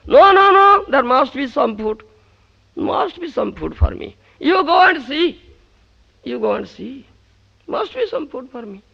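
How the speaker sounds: loud, high, pleading insistence; insistent. Acted. Krishna insisting Draupadi look for food. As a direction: insist there must be food.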